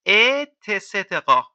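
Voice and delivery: اتسترا drawn out syllable by syllable, e-te-se-te-ra; slow model word. Shown as a demonstration. Teacher modelling et cetera slowly for students. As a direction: spell out اتسترا slowly for the class. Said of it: "اتسترا یعنی و غیره".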